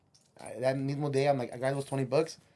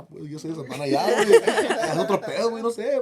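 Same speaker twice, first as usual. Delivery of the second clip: quoting Danny's boast through loud laughter, higher and louder; laughing while quoting; 6 semitones higher, 9 dB louder than usual. Retold or imitated. Retelling Danny's words to friends, laughing loudly. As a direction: laugh out the quote, breaking up. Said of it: "And he's like, Oh".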